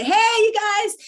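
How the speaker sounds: high, loud cheery call; excited greeting. Retold or imitated. Announcing a trip to friends, high loud excited call. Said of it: "just say".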